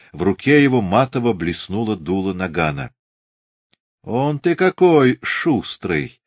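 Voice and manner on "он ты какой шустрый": slow, higher, slyly silky remark; insinuating. A narrator's reading. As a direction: draw it out with mock admiration. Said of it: "вкрадчиво проговорил Григорий".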